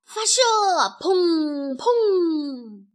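loud, high cry with drawn-out booms; firing command. Acted. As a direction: shout the order, then boom like cannon. Chicks fire the hedgehog cannonballs.

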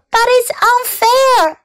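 put-on upset girl's high wail; crying out. A narrator's reading. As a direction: cry out against injustice. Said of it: "cried Fern".

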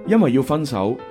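ordinary talk, nothing marked

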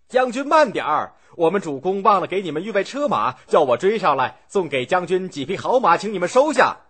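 high, loud shout across the water; loud calling out. A narrator's reading. Pursuer shouts from the shore to the departing boat, loud and high. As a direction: call after the boat to stop them. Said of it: "大声嚷道".

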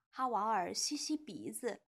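usual speaking voice

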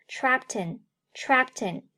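"trapped in" run together, t gliding into "in"; linked pronunciation. Shown as a demonstration. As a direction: slowly link "trapped in" as one word. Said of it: "Trapped和in连读".

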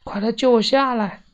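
high, urgent cry for rescue; calling for help. A narrator's reading. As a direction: call out to be rescued. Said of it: "我喊道". (高声呼救)

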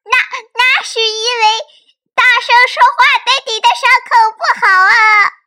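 high, loud, fast forced yell; straining to shout. Acted. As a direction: push the voice hard to sound big. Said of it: "扯着嗓子吼叫着".

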